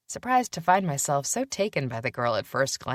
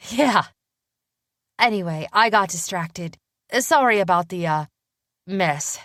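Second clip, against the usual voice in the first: put-on young woman voice; 4 semitones higher, 5 dB louder than usual. A narrator's reading. Willa speaking.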